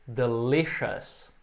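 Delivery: delicious drawn out very slowly; slow model word. Shown as a demonstration. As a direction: stretch delicious syllable by syllable.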